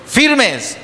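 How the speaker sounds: high barked military command; sharp call to attention; commander's order. Retold or imitated. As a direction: snap it out like a drill sergeant. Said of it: "el comandante les dicen".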